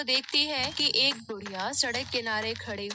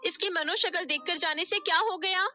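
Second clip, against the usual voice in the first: higher, louder blaming voices; accusing quarrel; 6 semitones higher, 4 dB louder than usual. Acted. Sister blames Nisha for father's death, accusing. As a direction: throw the blame hard.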